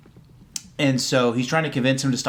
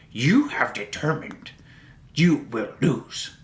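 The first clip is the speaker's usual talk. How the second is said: Yoda voice; slower than usual. Retold or imitated. Yoda voiced in retold scene.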